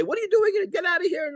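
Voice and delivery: a judge who might have screamed; mock angry yell. Imagined judge yells at woman, mock high shout. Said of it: "and screaming, yelling".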